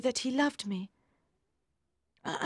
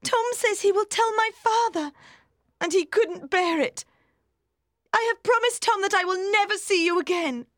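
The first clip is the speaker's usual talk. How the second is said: high, louder, fast distress; distressed girl voice; 12 semitones higher, 5 dB louder than usual. A narrator's reading. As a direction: blurt out through distress. Maggie distressed.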